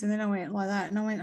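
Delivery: ordinary talk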